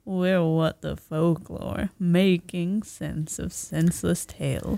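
slow, drawn-out delivery. Shown as a demonstration. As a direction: stretch every word out slowly.

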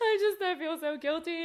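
high, loud laughing sob; half crying, half laughing through it. Retold or imitated. Telling her boss she feels guilty, laugh-crying. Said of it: "I was crying, hysterical".

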